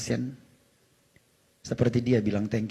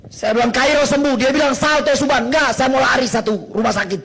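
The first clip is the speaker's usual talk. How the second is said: loud, high, exuberant retelling; elated; 12 semitones higher, 11 dB louder than usual. Retold or imitated. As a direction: shout the good news down the line. Elated retelling of phoning about healing. (bercerita lantang, meluap-luap gembira)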